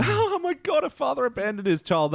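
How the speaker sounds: high, gushing fake amusement; mock hilarity. Podcaster mocking a repeated abandoned-family joke, sarcastic hilarity. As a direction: exclaim in sarcastic delight, starting very high.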